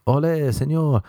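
cartoonish Spanish greeting accent; attempted Spanish accent. Shown as a demonstration. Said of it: "that's not a Spanish accent".

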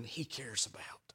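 soft unvoiced whisper; hushed tender whisper. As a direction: lean in and breathe it to them. Intimate sermon whisper.

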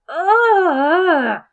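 long, loud groan of disgust. Real, not acted. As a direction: groan out in revulsion. Disgusted at the book passage.

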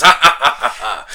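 put-on maniacal cackle, falling away; mock maniacal laugh. Retelling his reaction to [name], mock maniacal laughter. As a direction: imitate a maniac's evenly spaced ha-ha laugh. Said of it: "he laughs maniacally".

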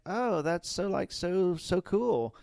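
a hint of a gushing teenager; teen girl voice. Mimicking the drive-thru girl, lightly bubbly teen. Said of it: "This is how she talked".

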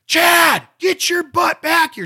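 put-on loud high shout of the kid's name; mother's loud high shout, calling the child back; mom yelling. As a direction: call the kid back like a mother. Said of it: "She's gonna go".

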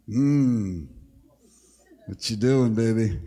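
lightly voiced low, tender phone answer; a hint of a husband melting on the phone; mild sweet-talk to his wife on the phone. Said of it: "But when Becky calls".

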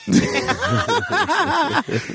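high, long belly laugh; helpless laughter. Real, not acted. Co-host cracking up at the singing, helpless laughter. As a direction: laugh till your stomach hurts. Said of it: "My stomach hurt".

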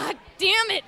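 high, loud comic frustration, half laughing; mock exasperation. Owner beaten to the toilet by cats, mock exasperation. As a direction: curse in playful outrage, pitch jumping up.

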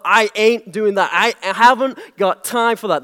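lightly voiced Southern refusal; Southern-accented brush-off. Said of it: "that's my southerner".

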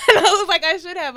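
high, laugh-filled admission. Real, not acted. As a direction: admit it through real laughter. Laughs at own missed chance.

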